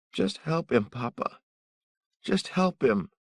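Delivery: put-on high, earnest child voice begging; a boy pleading with his father; young son begging his papa to help.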